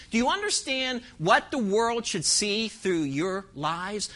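usual speaking voice

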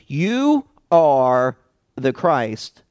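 slow, with "You" struck hard at the start; a firm, deliberate confession with weight on "You"; emphatic you. Shown as a demonstration. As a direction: land "You" firmly, then ease into the rest.

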